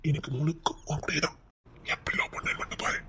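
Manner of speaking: breathy whisper holding back tears; tearful whisper. Acted. Husband tearfully whispers his love.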